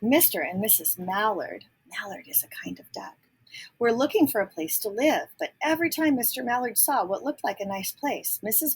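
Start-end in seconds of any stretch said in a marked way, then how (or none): 1.9-3.2 whispered aside. Real, not acted. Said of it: "whispered aside to listeners".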